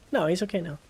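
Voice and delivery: normal talking voice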